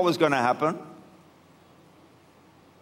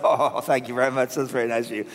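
mock-humble disciple; 5 semitones lower, faster than usual. Mock-humble disciple accepting praise.

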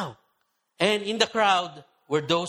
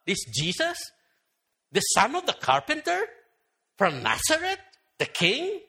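higher, scoffing crowd voice; mocking disbelief; 8 semitones higher than usual. Skeptical townsfolk mocking Jesus, in higher scoffing disbelief. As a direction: scoff at the carpenter's son claiming king. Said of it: "simply amused and were laughing".

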